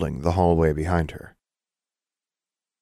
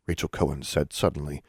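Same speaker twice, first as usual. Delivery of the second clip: ordinary delivery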